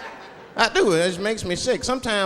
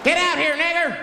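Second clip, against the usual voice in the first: high-pitched mob holler; menacing yell; 12 semitones higher, 3 dB louder than usual. Acted. Hooded men yelling at the caller outside, menacing yell. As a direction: call someone out, far higher than usual.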